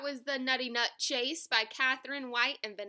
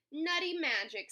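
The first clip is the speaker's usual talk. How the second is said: raised-pitch mild squeal; 6 semitones higher, 4 dB louder than usual. A narrator's reading. Hedgehog racing back to see the nut, squealing. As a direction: squeal it with excitement. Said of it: "squealed Hedgehog".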